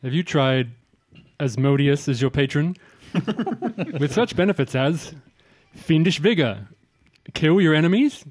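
salesman ad read voice; infomercial pitch. Acted.

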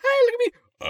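tiny high squeaky voice; voice-drop demo. Shown as a demonstration. Showing a teen voice before the drop, high squeak. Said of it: "Where you go from".

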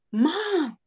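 high called-out name; calling out. Retold or imitated. Runner calling out to mother-in-law, high called-out name. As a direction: call to her across the crowd. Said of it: "to yell".

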